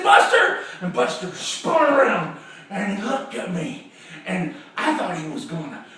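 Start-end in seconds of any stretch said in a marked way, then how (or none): 0.0-0.6 high, loud shout of a name; sharp shouted call. Acted. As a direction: holler after a runaway dog. Calling the runaway dog.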